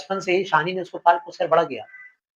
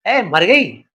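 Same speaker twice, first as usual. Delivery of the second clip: angry shout; 3 semitones higher, 5 dB louder, much slower than usual. Acted. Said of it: "चीख कर बोला".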